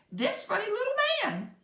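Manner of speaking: tiny queen pointing out her rescuer; mouse queen. A narrator's reading. Mouse queen introducing the woodsman.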